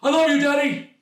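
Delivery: high, loud shout across the room; yelled across room. Retold or imitated. Son yelling love to dad across the room, loud high shout. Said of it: "just yell across the room".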